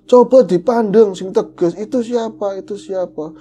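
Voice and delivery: high, insistent imitation of an urging uncle. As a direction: call out, pressing him to look properly. Uncle urges father to look.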